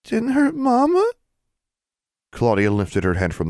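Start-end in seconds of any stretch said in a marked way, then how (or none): 0.0-1.1 put-on high, slow, small toddler question. A narrator's reading. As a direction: ask timidly like a scared child. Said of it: "in a very small voice".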